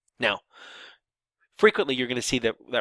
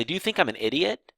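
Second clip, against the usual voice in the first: higher-pitched, pointed question; rhetorical question; 6 semitones higher than usual. Shown as a demonstration. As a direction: ask it without expecting an answer. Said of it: "You know, if I say".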